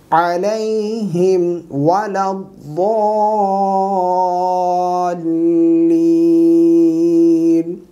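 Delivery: slow, sustained chanting; measured tajweed teaching recitation; Quran recitation. Shown as a demonstration. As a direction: recite with long drawn-out vowels.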